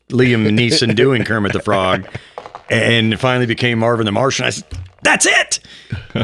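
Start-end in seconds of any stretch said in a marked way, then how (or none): 5.0-5.6 excited. Retold or imitated. Excited at finally nailing it.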